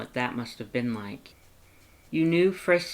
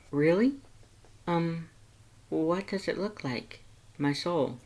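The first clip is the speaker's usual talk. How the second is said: higher, lighter heroine voice; curious young woman; 5 semitones higher than usual. Acted. Heroine asking Sans about Papyrus's call, higher and curious. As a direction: ask Sans gently, curious.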